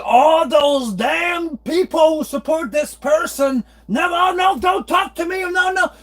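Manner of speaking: mock angry rant. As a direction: ham up the cranky dad's tirade. Imitating father ranting about politics.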